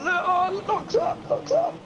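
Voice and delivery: very high-pitched urgent exclamations; excited alarm. Real, not acted. Commentator spotting a car locking up, excited alarm.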